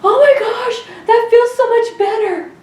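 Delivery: very high-pitched gush of relief; high, airy mock-swoon of relief; relieved exclamation. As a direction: re-enact amazed relief, voice way up. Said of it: "I remember saying".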